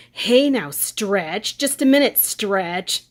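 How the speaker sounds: calling out to Bea Arthur in a loud mock voice; imitating the guy. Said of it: "and he goes".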